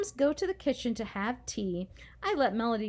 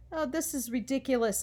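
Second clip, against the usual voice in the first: ordinary delivery, nothing marked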